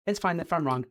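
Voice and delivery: high, airy, unbothered; a light mock shrug voice; breezy giving in. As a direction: toss it off like it doesn't matter. Self-quote, carefree surrender.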